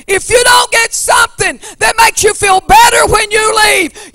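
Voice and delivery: loud, rising preacher's cry over the organ; full-force preaching. Acted. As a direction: drive it home to the congregation.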